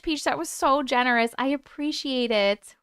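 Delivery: normal talking voice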